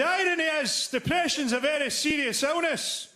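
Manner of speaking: hammed-up indignant heckler; mock pompous heckler. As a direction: lecture the comedian self-righteously. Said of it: "he went".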